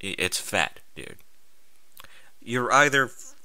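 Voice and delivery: normal talking voice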